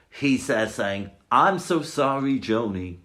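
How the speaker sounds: normal talking voice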